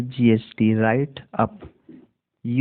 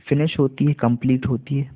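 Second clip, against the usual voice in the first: usual speaking voice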